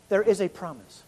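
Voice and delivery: ordinary talk, nothing marked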